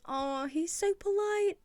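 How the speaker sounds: drawn-out, high-pitched coo; slow cooing, stretching the words out; adoring gush. Real, not acted. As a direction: melt over how sweet he is. Gushing over a polite character.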